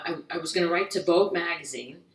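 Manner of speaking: usual speaking voice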